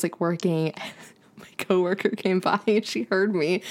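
normal talking voice

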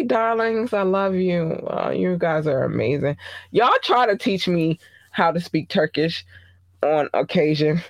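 usual speaking voice

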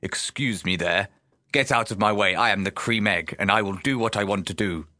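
haughty posh cream egg; posh rude character. Acted. Host auditioning as an advert egg, posh and rude. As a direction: order people about in a posh, imperious voice. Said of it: "I could be a posh, rude cream egg".

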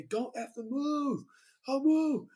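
imitating a cop with a shotgun; cop ordering. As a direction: play the cop barking at him. Undercover cop barks an order.

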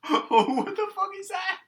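startled shriek. Real, not acted. Girl spotting a huge fly, startled shriek. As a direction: jump at the sight of a bug. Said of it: "acting like she saw snow for the first time".

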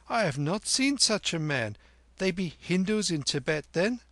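put-on high-pitched wondering boy; high, forthright youngster; curious boy Kim. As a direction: puzzle it out in a high boy's voice. Narrator plays Kim.

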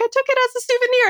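fast, high airy excuse; mock innocent. Host imitates Angelina's breezy excuse, fast and high. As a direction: rattle it off sweetly. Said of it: "she's like".

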